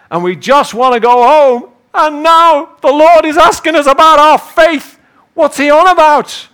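high, loud complaint building to outrage; exasperated disciples. Acted. Soaked disciples to each other about Jesus, loudly exasperated. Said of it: "looking at each other and saying".

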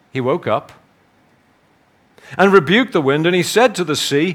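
measured, solemn Bible reading; scripture reading. Acted. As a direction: read the passage like a preacher at the lectern. Reads the gospel aloud.

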